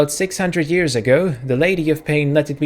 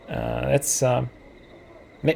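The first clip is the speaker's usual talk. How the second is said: ordinary talk, nothing marked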